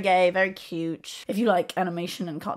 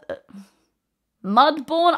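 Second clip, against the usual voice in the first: ordinary talk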